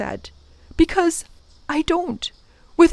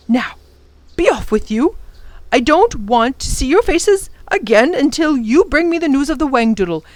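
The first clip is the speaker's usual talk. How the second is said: put-on higher, gentle reassuring old man; tender professor; 4 semitones higher than usual. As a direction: professor reassuring the children he'll wait, tenderly. Professor sends them off.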